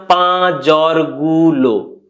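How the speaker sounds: "পা জ্বরগুলো" stretched very slowly; slow drawn-out repeat. Shown as a demonstration. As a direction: drag out "পা জ্বরগুলো" word by word. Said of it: "আর বলছি না". ("পা জ্বরগুলো" খুব ধীরে টেনে বলা)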